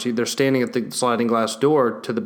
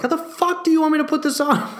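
much higher, disbelieving retort; incredulous. Retold or imitated. The owner to his girlfriend about the leash, a high incredulous retort. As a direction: sound like she asked the impossible.

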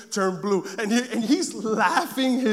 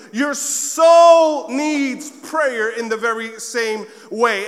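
slow, loud preacher's build; preaching; 6 dB louder, much slower than usual. Acted. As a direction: drive the point home from the pulpit. Preaching at full force.

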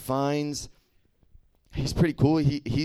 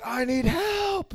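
much higher, slow, drawn-out plea; desperate cry for help; much slower than usual. Retold or imitated. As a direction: stretch it out like a stranded man begging. James Franco calling in the movie.

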